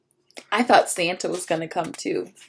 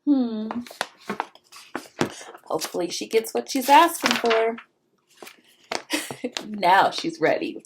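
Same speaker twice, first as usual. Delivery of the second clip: ordinary delivery, nothing marked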